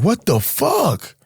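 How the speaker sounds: high, slow, stunned disbelief; staring at a shooting, aghast; shocked outburst. Retold or imitated. Young man seeing a friend shoot someone, stunned high cry. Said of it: "I'm like".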